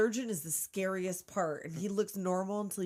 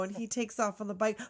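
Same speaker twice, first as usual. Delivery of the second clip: usual speaking voice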